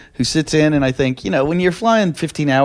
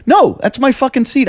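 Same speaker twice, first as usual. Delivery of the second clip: retold yelling; 10 semitones higher than usual. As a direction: act out a mild mock yell. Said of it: "I'm yelling at the flight attendants".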